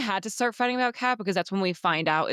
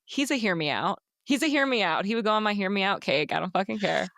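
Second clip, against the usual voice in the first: ordinary talk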